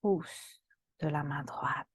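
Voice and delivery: usual speaking voice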